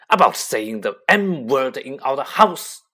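loud booming shout; thundered. Acted. Uncle thunders at Harry for saying magic, booming shout. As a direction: thunder it at the boy. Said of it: "thundered his uncle".

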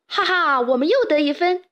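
high, gleeful cheer; happy cheer. A narrator's reading. Player celebrates another point, cheering happily. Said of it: "贝里奥开心地欢呼道".

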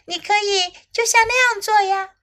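lightly voiced high snake; storybook snake's high, encouraging call. A narrator's reading. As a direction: pitch it up, lightly playing a snake. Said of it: "蛇喊道".

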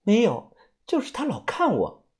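high, softened companion voice; uneasy female companion. Acted. Companion answering whether the beggar followed her, uneasy.

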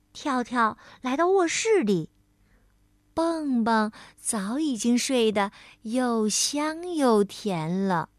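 usual speaking voice

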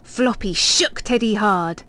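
ordinary delivery

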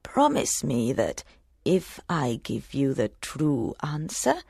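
low, soft crone voice, half whispered; old hag. Acted. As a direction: murmur it slyly like an old crone. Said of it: "said the hag".

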